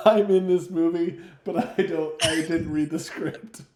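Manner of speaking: tease, laughing through the line; mock clueless actor. Mock quote of clueless player, laughing.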